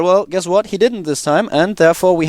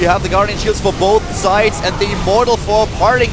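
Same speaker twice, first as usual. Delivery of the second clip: raised-pitch esports commentary; live play-by-play; 7 semitones higher than usual. Real, not acted. Esports caster calls the battle as it commences, play-by-play.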